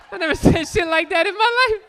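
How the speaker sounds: high, loud, laughing through it; can't get it out for laughing; laughing disbelief. Real, not acted. Comic cracking up at his own bit, laughing.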